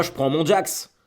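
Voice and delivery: nom du vélo lancé plus haut, avec élan, fin montante; fier de son vélo. Real, not acted. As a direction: annonce « Jax » comme une fierté. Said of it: "j'adore ce vélo". (nomme son vélo « Jax » avec enthousiasme)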